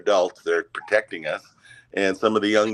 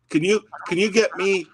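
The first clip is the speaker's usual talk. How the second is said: put-on little boy voice; 10 semitones higher than usual.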